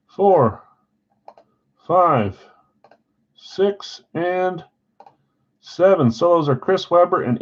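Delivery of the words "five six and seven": usual speaking voice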